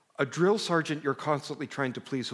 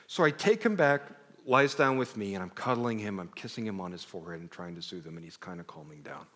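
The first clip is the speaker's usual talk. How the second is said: normal talking voice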